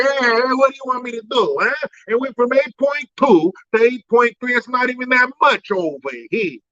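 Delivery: high, testy put-on old-man voice; mock irritated politician. Comedian mocking the president bristling at questions, in a high testy voice. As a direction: get defensive like a cornered leader.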